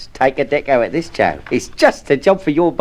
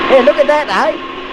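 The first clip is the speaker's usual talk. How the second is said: excited; 9 semitones higher, slower than usual. Acted. Proud demo of spray gun.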